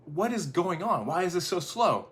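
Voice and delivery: acted exasperation, voice pushed up; mock impatience. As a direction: play the fed-up computer user. Acts out impatience at slow computer.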